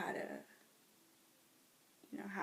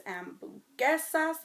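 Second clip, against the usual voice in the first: Spanish word; 8 semitones higher, 6 dB louder, slower than usual. Shown as a demonstration. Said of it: "I know how to say hamburgers".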